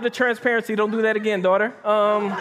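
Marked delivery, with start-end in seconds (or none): none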